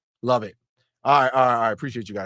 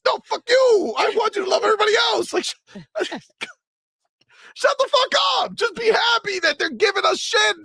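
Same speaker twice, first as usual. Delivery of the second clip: hammed-up high shouting, fast in places; doll voice as fans; faster than usual. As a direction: scream at the doll like a toddler's tantrum. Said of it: "this is you guys".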